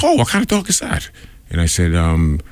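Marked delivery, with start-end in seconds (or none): 0.0-1.1 high-pitched retold stranger's question; imitated stranger in a high, softish voice; curious passer-by. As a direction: voice an inquisitive stranger, much higher. Retold passer-by asking about dog.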